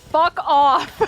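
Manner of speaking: loud mock snap; all caps fuck off. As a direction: read the chat insult loud. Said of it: "He said, all caps".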